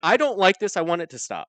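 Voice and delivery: hammed-up kid wanting it to stop; mock inner protest. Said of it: "you're going".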